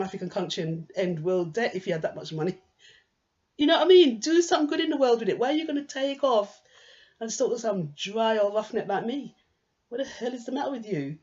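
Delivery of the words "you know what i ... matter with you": high, loud, fast disbelieving outburst; loud incredulous rant at the stalker; exasperated rant. Real, not acted. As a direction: demand to know what's wrong with them. Ranting at an imagined rich stalker.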